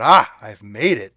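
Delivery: loud, elated burst of pride; thrilled. Retold or imitated. Newbie invited to the show place, loudly elated. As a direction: blurt it out like you've finally arrived. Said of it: "I thought".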